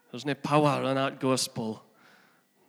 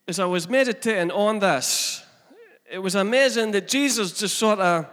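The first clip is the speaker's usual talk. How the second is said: fervent sermon voice, raised and driven; preaching; 4 semitones higher, 4 dB louder than usual. Acted. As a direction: preach it out to the congregation. Preaching with rising force.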